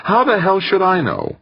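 high, irritated outburst; snapped. A narrator's reading. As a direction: bite back, exasperated. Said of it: "Molina snapped".

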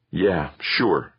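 ordinary delivery, nothing marked

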